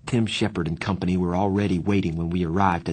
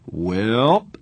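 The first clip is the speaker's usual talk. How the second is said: cheerful; 4 dB louder than usual. A narrator's reading. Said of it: "Two-Bit said cheerfully".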